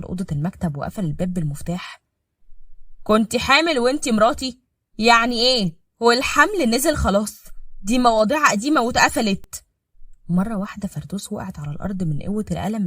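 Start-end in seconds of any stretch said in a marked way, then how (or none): 3.0-9.6 loud, raised accusing voice; angry confrontation. Acted. Husband confronting wife in locked office, angry. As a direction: hammer the question at her.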